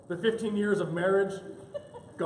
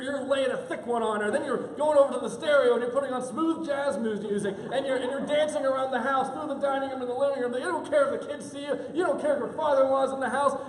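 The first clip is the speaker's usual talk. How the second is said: excited, raised-pitch comic build; fired-up preaching; 6 semitones higher, 5 dB louder than usual. Acted. Preacher urging husbands to adore their wives, fired up. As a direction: pile up each command with mounting zeal.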